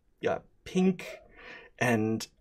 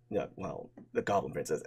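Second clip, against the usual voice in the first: ordinary delivery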